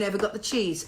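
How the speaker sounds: ordinary talk, nothing marked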